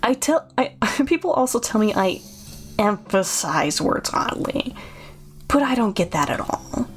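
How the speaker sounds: oddly paced, with key words drawn out; creepy innkeeper. Acted. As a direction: explain yourself haltingly, leaning on odd words. Voices Chuck explaining his lisp.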